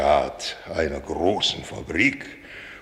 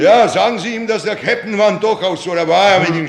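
blustering; 11 semitones higher, 5 dB louder, faster than usual. Acted. Captain announcing himself grandly.

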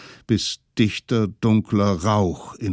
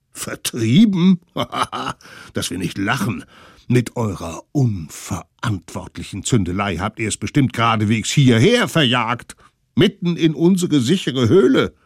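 spöttisch; 4 semitones higher, 6 dB louder than usual. Acted. Eltern lachen die Kinder aus.

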